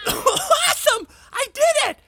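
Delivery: triumphant high cheer; thrilled it worked; excited. Real, not acted. Spell works, cheers.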